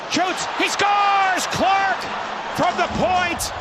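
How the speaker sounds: high excited goal call. Real, not acted. Goal call by play-by-play announcer.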